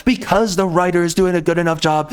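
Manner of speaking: ordinary talk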